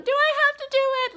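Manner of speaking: high fast whine; whiny child. Retold or imitated. Son whining to mom, complaining. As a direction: whine like a kid dodging homework. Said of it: "Like whining, crying, fussing".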